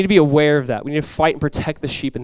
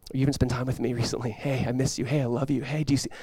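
very soft, gentle appeal; tender calling; 3 semitones lower, 10 dB quieter than usual. Acted. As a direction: call quietly to someone you love and miss. Voices Jesus calling tenderly at night.

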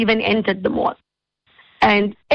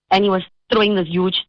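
ordinary talk, nothing marked

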